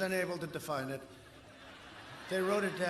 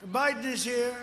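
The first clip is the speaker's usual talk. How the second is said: mock announcer; 4 semitones higher, 6 dB louder than usual. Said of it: "They say".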